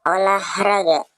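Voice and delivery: exercise counting. Acted.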